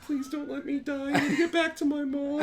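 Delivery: high-pitched mock terrified kid; frightened kid pleading. Bully clinging to an older kid's arm, frightened pleading. Retelling a scared child's plea.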